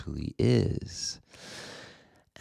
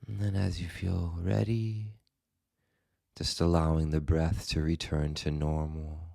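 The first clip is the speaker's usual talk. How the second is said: guided meditation; 3 dB quieter than usual. Acted. Meditation teacher guiding listeners into the body and breath, calm guided meditation. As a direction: guide them gently, leaving space after each phrase.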